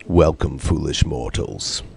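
deep, slow haunted-house host; menacing low welcome, drawn out; slow theatrical villain menace. Shown as a demonstration. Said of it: "could you say".

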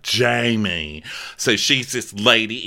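normal talking voice

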